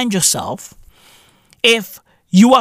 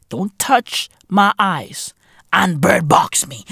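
ordinary talk, nothing marked

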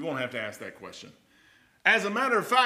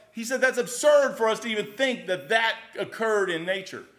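louder, driving sermon delivery; preaching at full force; 5 semitones higher, 5 dB louder than usual. Acted. Preacher arguing Darwin to the congregation, forceful and loud. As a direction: press the point home to the pews.

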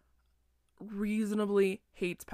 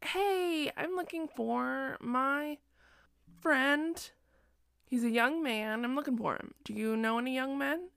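put-on eager young seeker; 6 semitones higher than usual. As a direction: call out brightly, hunting for a friend. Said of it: "And he's like".

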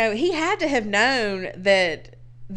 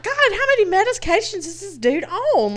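much higher, disbelieving inner voice; incredulous; 12 semitones higher than usual. Retold or imitated. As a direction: marvel at it in mock shock. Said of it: "I'm like".

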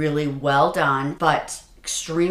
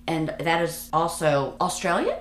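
usual speaking voice

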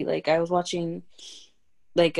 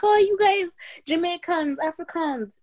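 high-pitched mock royal voice; the Queen; 10 semitones higher than usual. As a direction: put on a hammed-up regal call. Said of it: "when the Queen was like".

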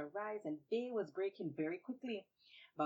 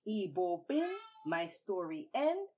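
closing formula; 7 dB louder, slower than usual. A narrator's reading. Traditional story closing formula.

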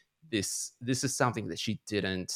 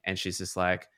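usual speaking voice

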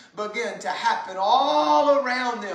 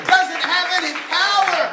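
preaching at full force; 3 semitones higher than usual. Acted. Preacher shouting to the church, full force. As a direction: thunder it at the congregation.

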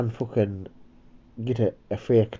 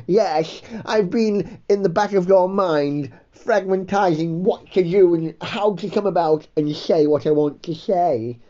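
higher, prim and precise; a fussy gent explaining himself; higher, mannered, deliberate voice; Cecil's voice; 6 semitones higher than usual. Acted. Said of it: "Jeff and fucking Cecil".

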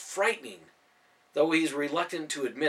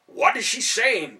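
frustrated demand; 9 semitones higher, 8 dB louder, slower than usual. A narrator's reading. Ben frustrated, can't understand.

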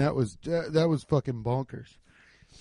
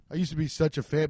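usual speaking voice

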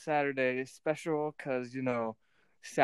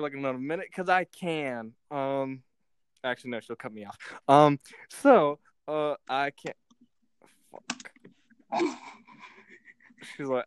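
usual speaking voice

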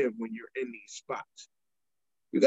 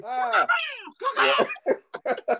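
raven caw; much slower than usual. Retold or imitated. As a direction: call out like a cawing raven. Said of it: "You kept saying to people".

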